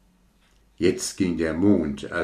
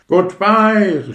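high, loud shout; shouted goodbye; 12 semitones higher, 9 dB louder than usual. Acted. Fireball shouts farewell while shooting off, high shout. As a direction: fling it out as it shoots off. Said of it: "schrie die Feuerkugel".

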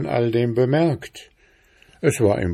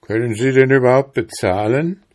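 louder, higher and quick, rising at the end; indignant question; 4 semitones higher, 6 dB louder, faster than usual. A narrator's reading. Bear coachman asking the passenger about the fare, loud indignant question. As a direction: demand it fast and loud.

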